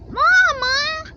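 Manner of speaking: put-on high, alarmed child cry of Mama; high-pitched alarmed little girl; sister crying out. A narrator's reading. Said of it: "cried sister".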